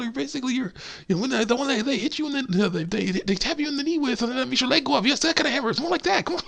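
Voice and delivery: rambling, very high falsetto; squeaky salesman improvising reassurances as he goes; coaxing walrus cook. Retold or imitated. Walrus offering Crash a massage beforehand, rambling coaxing falsetto. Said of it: "doing the same thing with this walrus".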